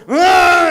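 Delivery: loud, high imitated toddler shriek; toddler's yell. Baby brother barging into the omok game, imitated yell.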